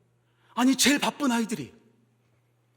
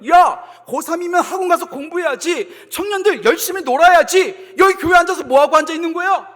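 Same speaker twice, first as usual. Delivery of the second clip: loud, high, jeering reproach; mocking scolding; 9 semitones higher, 13 dB louder than usual. The world to praying students, loud mocking scolding. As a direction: scold them scornfully at full voice. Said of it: "조롱할 겁니다".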